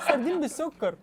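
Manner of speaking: high laughing squeal; cracking up at the taste; laughing disgust. Real, not acted. Reacting to sardines with sugar.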